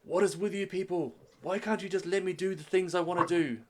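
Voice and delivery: loud, high, frustrated outburst; exasperated prince. Acted. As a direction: rail at the parents in exasperation. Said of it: "it's like".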